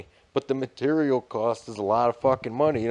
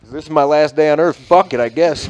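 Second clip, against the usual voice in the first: loud, raised-pitch acted outburst; mock reckless declaration; 4 semitones higher, 11 dB louder than usual. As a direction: play someone snapping and going for broke. Voices worker snapping before rampage.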